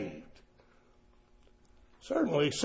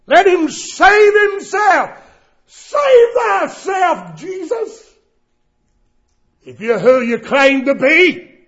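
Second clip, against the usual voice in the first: much higher, loud, slow sneering shout; mocking taunt; 8 semitones higher, 5 dB louder, slower than usual. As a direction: hurl the taunt up at the cross. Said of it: "Here they're mocking".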